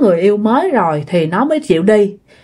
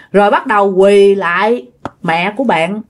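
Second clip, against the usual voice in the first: plain reading voice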